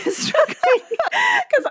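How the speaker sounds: high laughing restart; giggling start. Real, not acted. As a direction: laugh breaking into the words. Laughing aside.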